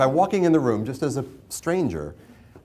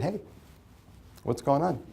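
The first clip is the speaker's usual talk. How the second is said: a calm, easy hey, not raised; casual and unbothered, hands in pockets; relaxed and calm; 4 semitones higher, 4 dB quieter than usual. Shown as a demonstration. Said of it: "hands in my pockets and my shoulders relaxed".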